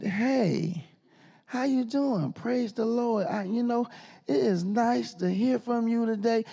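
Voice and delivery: lightly mock the overly polite caller; sugary nice phone voice. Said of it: "I never heard her talk so nice".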